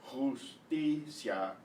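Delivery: "justicia" slowly split, khoos, TEE, syah; syllable by syllable. Shown as a demonstration. Teacher breaking the word up for students, "justicia" slow, syllable by syllable. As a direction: stretch "justicia" into three separate syllables. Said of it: "separate syllables".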